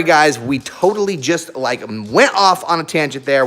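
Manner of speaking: usual speaking voice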